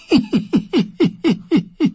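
put-on high eerie old woman's cackle; high cackling seeress; crone's giggle. A narrator's reading. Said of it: "Erneut kicherte sie".

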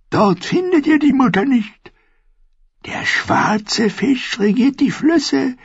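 put-on high, eerie old woman's voice; prophesy like a strange old crone; high crone telling her dreams; old dwarf seeress. A narrator's reading. Seeress contradicts Lord Beric.

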